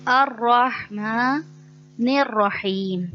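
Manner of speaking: slow, measured tajweed chant; Quranic recitation. Shown as a demonstration. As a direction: recite the verse as in prayer.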